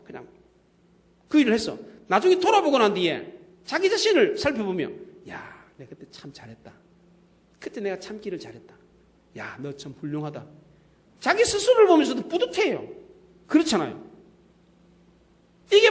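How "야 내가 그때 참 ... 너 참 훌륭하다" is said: very soft, low murmur to oneself; acted inner voice, barely audible; hushed self-praise. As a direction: murmur it privately, as if patting yourself on the back. Quietly praising oneself in looking back.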